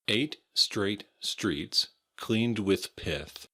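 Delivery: slow tongue-twister demonstration; slow, deliberate articulation; careful tongue twister. Shown as a demonstration. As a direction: separate every word, slowly and precisely. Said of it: "a little bit of a tongue twister".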